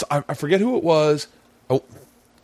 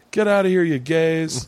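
higher-pitched, restrained shout; mock heckling yell; 4 semitones higher than usual. Angry stranger to paddleboarders, mock yell. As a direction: holler at them as if from afar, held back. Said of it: "this guy yells, uh, at us like".

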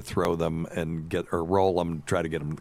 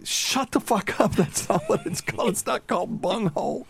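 mock exasperation. Son-in-law scolding mother-in-law on the beach, high mock voice. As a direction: scold her in a squeaky mock voice. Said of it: "And I'm like".

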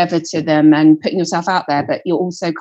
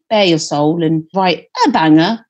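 ordinary talk

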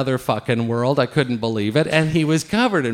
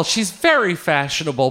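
ordinary delivery

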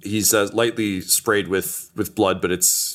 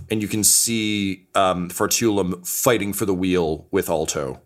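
normal talking voice

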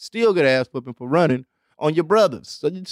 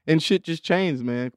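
ordinary talk, nothing marked

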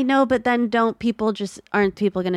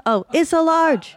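high mock surprise; 9 semitones higher than usual. Acting the shirt-size bit.

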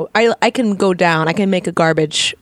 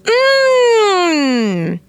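pleasure moan; 13 semitones higher than usual. Real, not acted. Savoring first bite of snack.

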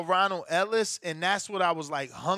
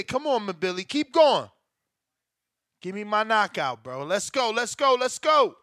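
yelling from the sidelines; 3 semitones higher, 5 dB louder than usual. Real, not acted. Cheering fighter on during bet.

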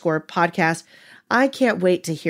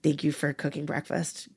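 soft, sincere apology, much quieter; 4 semitones lower, 8 dB quieter than usual. Retold or imitated. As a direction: gently make amends to your mother. Apologizing at mom's door.

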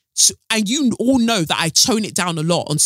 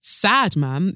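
usual speaking voice

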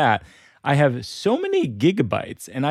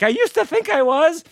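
very high comic wail; mock despair. Locked-out owner doubting own identity, mock tearful. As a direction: whimper it out in hammed-up self-doubt.

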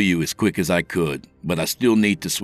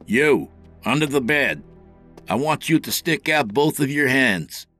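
raised, high-pitched authoritative orders; stern police command; 10 semitones higher, 3 dB louder than usual. A narrator's reading. Officer ordering the intruder from under the bed, commanding. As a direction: lay down a firm, forceful ultimatum.

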